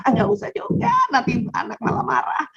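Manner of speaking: ordinary delivery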